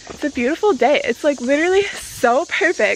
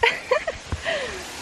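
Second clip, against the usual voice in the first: high nervous giggle; 14 semitones higher, 7 dB quieter than usual. Real, not acted. Lightheaded at the cliff.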